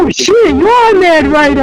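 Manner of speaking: impressed friends. Retold or imitated. Friends hearing the new name, amazed and high. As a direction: gasp it out like they just got it.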